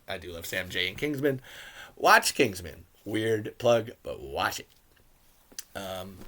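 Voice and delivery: usual speaking voice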